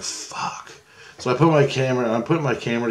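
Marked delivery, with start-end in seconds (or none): 0.0-0.7 whispered fuck; whispered curse. Retold or imitated. Annoyed by the crowd, whispered curse.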